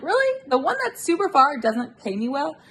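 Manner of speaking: sharp high "Really?" then indignant; incredulous, fed-up question; exasperated disbelief. Retold or imitated. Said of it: "I'm like".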